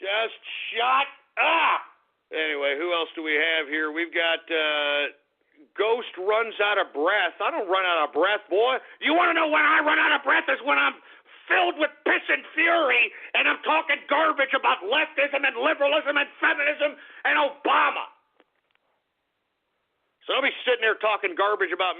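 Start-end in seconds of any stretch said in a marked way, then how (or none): 0.0-1.8 slow, stretched-out, high-pitched snap; fed up with the heckler; exasperated outburst. Real, not acted. Snapping at a taunting prank voice. 9.0-18.1 high, heated, worked-up tirade; furious self-defence in a raised pitch; indignant rant. Real, not acted. Indignant rant answering a troll.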